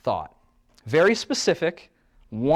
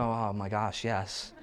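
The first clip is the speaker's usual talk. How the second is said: blissed out; 5 semitones lower, 9 dB quieter, slower than usual. Retold or imitated. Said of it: "And you go".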